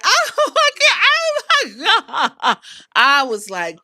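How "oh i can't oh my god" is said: high, laughing mock Bianca; laughing disbelief. Bianca laughing in disbelief at the chaos, high and giggly. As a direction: giggle through the words in disbelief. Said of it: "Bianca was like".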